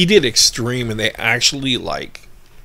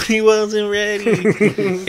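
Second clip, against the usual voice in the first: high, long-drawn mock lament; teasing mockery; 10 semitones higher, much slower than usual. Friend ribbing the storyteller who wasn't ready, mocking. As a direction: stretch it out high to tease. Friend teases teller's lack of readiness.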